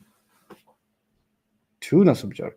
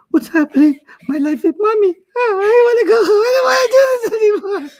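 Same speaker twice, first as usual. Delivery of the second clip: put-on high, loud wailing child voice; hammed-up sobbing student, voice way up; mocking crying kid; 4 dB louder than usual. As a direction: wail like a kid who wants to quit. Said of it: "you're like".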